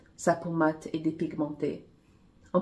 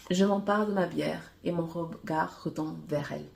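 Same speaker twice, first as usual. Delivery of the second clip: ordinary delivery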